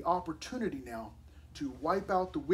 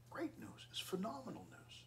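whispered; 6 semitones higher, 11 dB quieter than usual. Real, not acted. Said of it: "pastor repeating good news".

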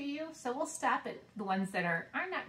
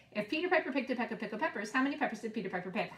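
fast tongue twister; 3 semitones higher, much faster than usual. Shown as a demonstration. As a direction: rattle it off fast. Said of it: "Should I go faster?".

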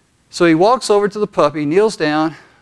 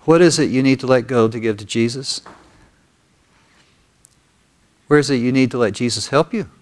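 guided prayer; 2 semitones lower than usual. Real, not acted. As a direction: lead them gently into prayer, leaving room after each phrase. Leads congregation in prayer.

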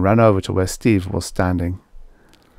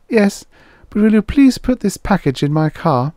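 hurt and much higher pitched; 12 semitones higher, 4 dB louder than usual. A narrator's reading. The mayor's wife, hurt but asking Slim a favour, high sweet voice. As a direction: ask sweetly in a high wounded voice. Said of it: "She looked hurt again".

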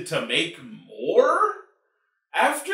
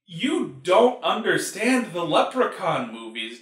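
normal talking voice

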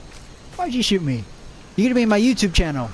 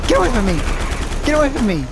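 panicked yell; 6 semitones higher, much faster than usual. Real, not acted. Gamer chased by an enemy, panicked yell. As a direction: shout it while fleeing. Fleeing an attacker.

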